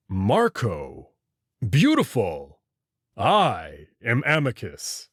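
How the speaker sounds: low, slow, intrigued wolf-man voice; wolf creature. Acted. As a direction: let it purr low like a big beast pleased with a name.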